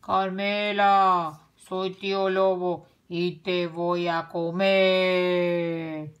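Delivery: low, slow, booming threat; thundering wolf. A narrator's reading. Tío Lobo outside the house at night, low thundering voice. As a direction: boom it out, stretching the last word. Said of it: "tronó una voz".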